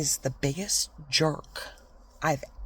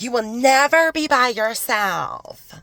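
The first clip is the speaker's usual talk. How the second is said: higher, louder, slow sing-song promise; hammed-up bubbly coworker imitation; mocking office manager; 9 semitones higher, 6 dB louder, slower than usual. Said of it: "That's how she talked".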